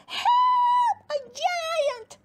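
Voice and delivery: high frantic yell; panicked shout. Acted. Campion runs through the village yelling about the giant. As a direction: scream the alarm as you run.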